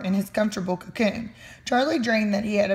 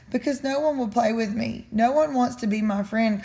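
ordinary delivery, nothing marked

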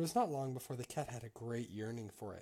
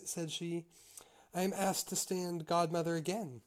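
higher, louder read-aloud storytelling voice; story narration; 4 semitones higher, 4 dB louder than usual. A narrator's reading. Reader telling a Grimm tale to listeners, story narration. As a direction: read it out clearly as a fairy tale.

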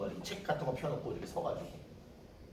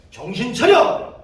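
slow, booming high yell; startling shout; 8 semitones higher, 14 dB louder, slower than usual. Retold or imitated. Preacher startling cult missionaries at the end, sudden shout. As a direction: bellow it to make them jump. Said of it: "깜짝 놀래".

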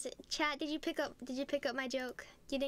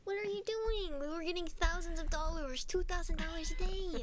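higher-pitched, agitated protest; exasperated complaint; 4 semitones higher, 3 dB louder than usual. Pretend. Player scolding partner over lost profit, exasperated. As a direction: protest the losses in a raised pitch.

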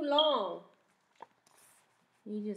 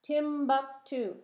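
Timbuktu said slowly, beat by beat; slow syllables; slower than usual. Shown as a demonstration. Teacher counting syllables with the class, slow. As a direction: draw out Timbuktu so each syllable stands apart. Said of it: "Three syllables".

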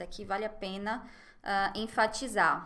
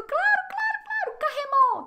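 much higher, louder eager cries of claro; bubbling over with eager agreement; excited; 8 dB louder than usual. Retold or imitated. The optimistic doctor keenly agreeing, high excited cries. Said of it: "ele tá muito empolgado".

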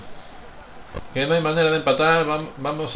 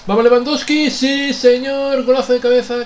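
goal celebration; 9 semitones higher, 6 dB louder than usual. Real, not acted.

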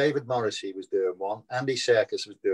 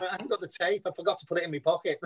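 laughing; 5 semitones higher, 5 dB quieter, faster than usual. Real, not acted. Laughing through retold outtake.

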